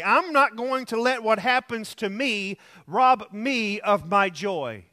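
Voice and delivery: normal talking voice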